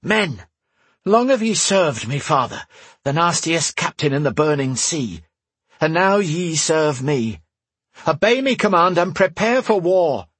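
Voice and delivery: rally the crew like a pirate captain. Acted. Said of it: "shifting into her pirate voice".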